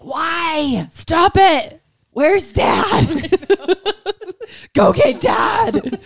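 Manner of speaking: high, hammed-up wail through laughter; mock outburst. Overwhelmed mother sending kids to Dad, mock wailing shout. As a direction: cry out in playful desperation, stretching the last plea.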